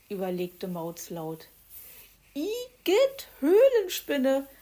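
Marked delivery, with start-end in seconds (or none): 2.3-4.5 high, loud, drawn-out cry of disgust; squealing igitt; disgusted shriek. Acted. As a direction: recoil at the word spider. Disgust at the spider.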